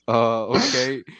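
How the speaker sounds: high, hesitant uh okay; startled. Retold or imitated. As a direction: caught off guard, unsure. Startled reply to doctor.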